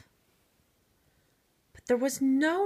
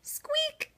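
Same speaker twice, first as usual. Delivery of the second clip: very high squeak breathed without voice; a tiny squeak from the wall; whispered squeak; 5 dB quieter than usual. Acted. Mouse squeaks in the night.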